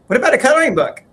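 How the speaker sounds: high and loud, bursting with the idea; eager idea. Retold or imitated. Husband imitating wife pitching the coloring book, high excited eagerness. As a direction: sell it like a sudden inspiration. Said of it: "she's like".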